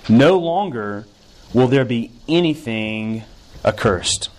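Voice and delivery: slow, emphatic reading of the verse; scripture reading. Acted. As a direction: lean into No longer, then let it fall. Reads second scripture verse.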